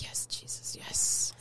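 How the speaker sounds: breathy whispered thanks; relieved whisper. Retold or imitated. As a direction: whisper it like a grateful prayer. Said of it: "I'm like".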